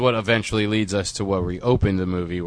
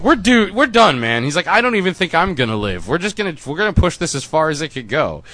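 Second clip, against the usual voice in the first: higher, louder acted panic; mock desperation; 7 semitones higher, 5 dB louder than usual. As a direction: play a man who thinks he's done for. Voicing a doomed character's desperate resignation.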